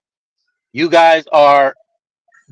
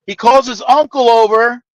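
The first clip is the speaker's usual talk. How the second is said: normal talking voice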